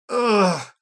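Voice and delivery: loud, exasperated ugh; frustrated groan. A narrator's reading. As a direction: groan at an impossible task. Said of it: "he says".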